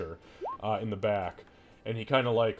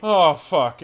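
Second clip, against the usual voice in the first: loud exasperated groan of a curse; dismayed curse; 6 semitones higher, 10 dB louder than usual. Acted. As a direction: let the bad news sink in. Butcher dismayed again.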